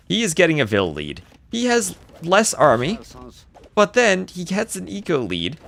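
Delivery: high, lively caster hype; excited play-by-play. Real, not acted. Caster hyping Mister Yo's boom, excited and high. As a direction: sell the comeback with energy.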